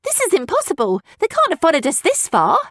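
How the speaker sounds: put-on high, alarmed girl's voice; frightened traveller girl. A narrator's reading. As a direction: panic at the news.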